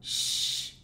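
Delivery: sh hissed out; isolated sh for learners; sh sound held briefly; sh sound demo. Shown as a demonstration. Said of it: "pronounced in Luxembourgish sh".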